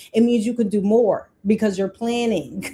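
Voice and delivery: ordinary delivery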